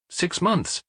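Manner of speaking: short, grudging answer; a touch of curt reluctance. A narrator's reading. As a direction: give it up reluctantly. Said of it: "curtly and reluctantly".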